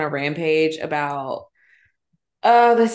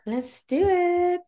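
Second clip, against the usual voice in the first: high, drawn-out sing-song sign-off; 14 semitones higher, much slower than usual. Real, not acted. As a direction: stretch it out high on a held note. Sing-song cue into the break.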